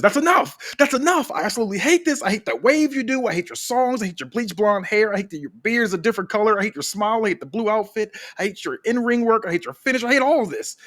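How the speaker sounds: a villain's ranting meltdown; angry rant. Acted. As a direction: spit out every hate like a sore loser. Heel wrestler's angry rant.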